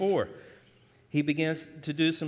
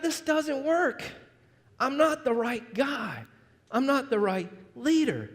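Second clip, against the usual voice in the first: mock-crying Moses griping to God; whiny Moses; 7 semitones higher than usual. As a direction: drag it out in a high moaning whine. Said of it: "that Moses is always crying about".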